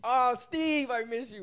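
high, very loud playful wail; mock longing cry. Co-host calling to absent Steve, joking loud lament. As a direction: call out with hammed-up yearning.

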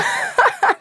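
very high burst of laughter. Real, not acted. Laughing at covid joke.

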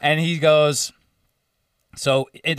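ordinary talk

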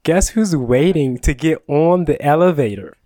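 usual speaking voice

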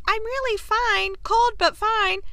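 high, light voice for a soaked sister; acted, high-pitched insistence of being fine; put-on reassurance. Edwina reassuring them after her dunking, put-on reassurance.

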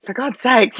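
exasperated outburst edged with a laugh; frustrated. Retold or imitated. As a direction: voice her daughter's imagined frustration. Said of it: "she must just be so frustrated".

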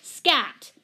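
loud, sharp shooing shout. A narrator's reading. Missus Pennypacker guarding her doorway from the cat, shouting. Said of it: "flapping her skirts and shouting".

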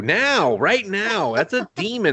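high-pitched comic rant; frantic pleading. Retold or imitated. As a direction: plead in mounting mock alarm. Acts out frantic plea about bird.

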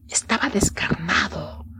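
susurro grave y sin voz; susúrralo para asustar; susurro de terror. A narrator's reading. Narración de terror. (el narrador susurra el detalle macabro)

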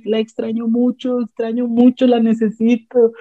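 high, tearful voice as the grieving dad; retelling the anime father missing his wife, high and tearful; mock crying dad. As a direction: imitate the widower choking up, pitched high. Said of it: "el vato empieza a llorar así".

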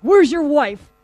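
insistent mock police questioning; police demand. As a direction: play an officer demanding answers. Police questioning a suspect, acted.

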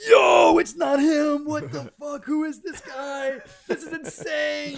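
high, loud, slow disbelief; shocked shouting players. Retold or imitated. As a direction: yell it out in stunned disbelief.

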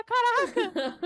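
sudden high astonished "Caraca!"; espanto. Retold or imitated. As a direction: blurt it out in shock. Irmão reage ao salve. ("Caraca!" num grito de espanto alto e agudo)

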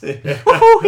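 whooping falsetto, very loud; Charles Martinet as Mario. Acted. Nightmare Mario actor in the lift, gleeful squealed whoop. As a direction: let out a huge cartoon whoop. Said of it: "Charles Martinet, the voice of Mario".

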